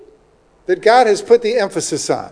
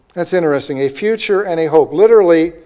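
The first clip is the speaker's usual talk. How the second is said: ordinary talk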